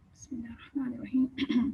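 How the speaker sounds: a whispered بسم اللہ before reciting; whispered basmala. Shown as a demonstration. Teacher softly whispering before reciting. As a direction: breathe the opening words softly.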